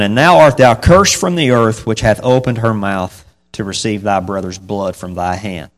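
loud, formal Bible reading; scripture reading. Acted. Preacher reading Genesis aloud to the congregation, loud and formal. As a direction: proclaim the verse to the congregation.

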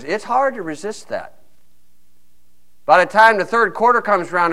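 usual speaking voice

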